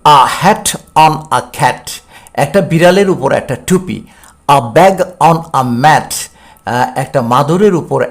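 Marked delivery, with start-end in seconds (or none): 0.0-1.9 A cat on a mat, read very slowly; careful teacher's model sentence; slow English model reading. Shown as a demonstration. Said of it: "আমি অর্থসহ উচ্চারণ করার চেষ্টা করছি". 4.5-6.1 A cat on a mat, read very slowly; careful teacher's model sentence; slow English model reading. Shown as a demonstration. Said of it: "আমি অর্থসহ উচ্চারণ করার চেষ্টা করছি".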